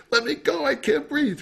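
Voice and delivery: high, squeezed plea; playful mock panic from a wrestling sibling; mock squeal. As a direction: squeal like a kid caught in a bear hug. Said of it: "and you say".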